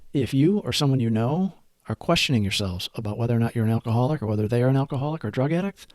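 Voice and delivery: usual speaking voice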